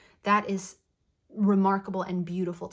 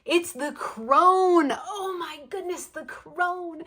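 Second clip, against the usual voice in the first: high, drawn-out delighted announcement; excited reveal; 9 semitones higher, 5 dB louder, slower than usual. Real, not acted. Card reader reveals the crone to her crew, excited and high. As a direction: gush over the big reveal.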